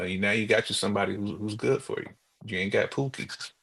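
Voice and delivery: ordinary delivery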